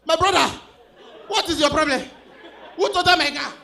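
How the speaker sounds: loud, high, exploding car owner; hammed-up enraged shouting; furious outburst. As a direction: yell it in disbelief, phrase by phrase. Car owner explodes at bad news.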